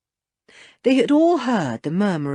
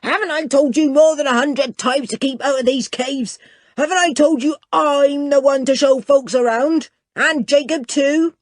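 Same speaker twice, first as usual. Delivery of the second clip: loud, higher furious shouting; angry shouting; 8 semitones higher, 5 dB louder than usual. Acted. Ebenezer shouts furiously at old Jeremiah. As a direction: berate the old man, fist raised. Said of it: "Ebenezer came shouting".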